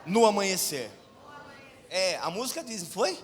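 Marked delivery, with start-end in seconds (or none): 0.0-0.9 call and response. Shown as a demonstration. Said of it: "diga comigo assim".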